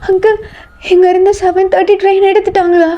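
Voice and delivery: high, distressed voice; tearful panic. Acted. Girl asking a station uncle about the train, panicked. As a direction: ask desperately, close to tears. Asks desperately whether train has left.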